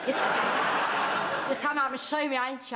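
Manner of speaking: slowed right down, leaning on up; chuckling slow insistence; slow insistent repeat. Acted. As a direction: drag it out, making sure she hears. Nan repeats the invitation insistently.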